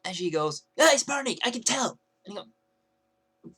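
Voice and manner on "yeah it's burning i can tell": loud, high mocking retort; bitchy insistence. Girl insisting to the kiosk guy it's burning, bitchy. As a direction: imitate her snapping back, pushy. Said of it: "decided to say it like that".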